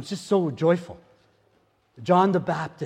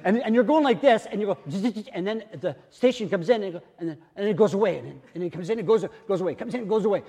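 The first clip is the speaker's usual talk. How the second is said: playful zzz static noise, fading in and out; radio tuning mimicry; 5 semitones higher, faster than usual. Shown as a demonstration. Preacher miming radio static to explain hearing God. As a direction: act out a radio drifting off station. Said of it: "this is gonna be heavy".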